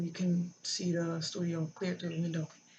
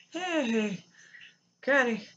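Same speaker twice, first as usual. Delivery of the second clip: bright, loud, higher, too-casual hello; higher, louder mock-cheerful call to grandma; forced cheerful greeting; 6 semitones higher, 7 dB louder, much slower than usual. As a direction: pretend nothing is wrong, act breezy.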